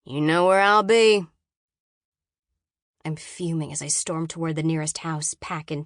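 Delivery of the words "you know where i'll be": loud, lazy call from a bored guard. A narrator's reading. Guard telling his charge where he'll wait, bored. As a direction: call it out loudly, with no real interest. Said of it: "he calls out in a bored voice".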